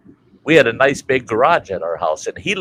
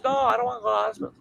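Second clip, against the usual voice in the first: put-on whiny old man; 14 semitones higher, 5 dB quieter, much faster than usual. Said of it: "He says".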